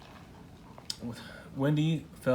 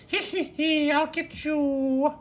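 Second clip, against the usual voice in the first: high, slow witch cackle; 5 dB louder, slower than usual. A narrator's reading. Wog showing off her frightening cackle, witch cackle. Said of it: "She cackled".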